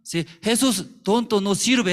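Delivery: loud, high mocking Satan voice; Satan taunting. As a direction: shout it like Satan jeering. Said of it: "Satanás hablaría". (burla de Satanás a gritos)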